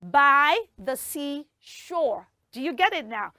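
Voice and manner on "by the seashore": very slow, with each word set apart; word-by-word teaching. Shown as a demonstration. Host explains the tongue twister, very slow and separated. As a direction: spell out the phrase slowly, word by word.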